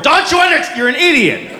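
high-pitched exasperated scolding; fed-up parent losing it with a small child; exasperated dad. Acted. Dad arguing with a three-year-old, exasperated. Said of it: "Anytime you're like this with a three-year-old".

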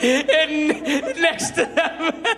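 a vain host breaking down and ranting his own greatness; mock sobbing Lipton. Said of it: "he starts crying".

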